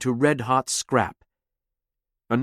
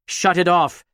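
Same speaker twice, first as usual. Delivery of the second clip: panicked plea; 5 semitones higher, 4 dB louder than usual. Acted. Nemi panicking.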